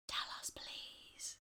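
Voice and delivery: breathy pleading whisper. Real, not acted. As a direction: whisper it, begging. Begging host whispers.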